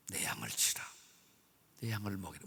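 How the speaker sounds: low soft whisper of Jesus; hushed tender commission; whispered command. Acted. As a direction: breathe the charge gently.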